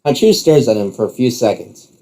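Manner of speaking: usual speaking voice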